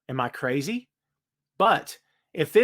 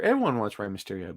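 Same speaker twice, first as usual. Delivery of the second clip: ordinary delivery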